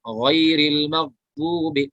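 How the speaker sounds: गैरिल मगदूबी read slowly and gently; slow recitation. Shown as a demonstration. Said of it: "कलकला नहीं होगा".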